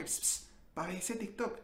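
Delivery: hushed creepy psst; furtive request. Retold or imitated. Sidling up to kids for their phones, hushed and furtive. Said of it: "yo les hago como que".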